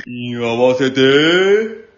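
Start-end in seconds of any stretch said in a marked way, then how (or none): none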